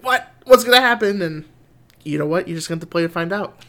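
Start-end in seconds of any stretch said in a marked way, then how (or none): 0.0-1.2 high, loud eager gasp of curiosity; excited wondering. Retold or imitated. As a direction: blurt it out, dying to know. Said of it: "your mind just goes like".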